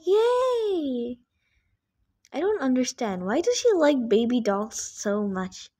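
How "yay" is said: high squealed cheer; high-pitched gleeful squeal; imitating sister's joy. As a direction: mimic the little sister's happy yay. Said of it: "She's like".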